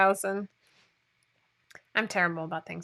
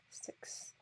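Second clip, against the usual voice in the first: breathy whispered aside; 22 dB quieter than usual. Real, not acted. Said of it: "whispered aside".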